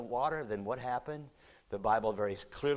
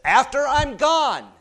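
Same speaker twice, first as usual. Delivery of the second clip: 1 Peter pleading; 14 semitones higher, 14 dB louder than usual. Acted. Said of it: "But Peter says".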